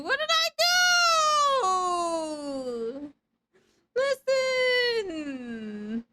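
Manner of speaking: high, loud wounded mock-crying shout; mock outrage. Streamer to teasing chat, high mock-crying outburst. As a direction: wail it like you've been wronged.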